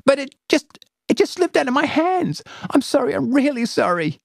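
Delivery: put-on high tearful pleading girl; near tears. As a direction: apologise on the verge of crying. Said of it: "close to tears".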